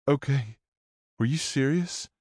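put-on high, pleading teen girl; young woman voice. A narrator's reading.

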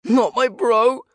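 high, loud, slow wailing shout; grief-stricken yell. Acted. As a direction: howl it over a fallen friend. Rogue grieving friend, charging.

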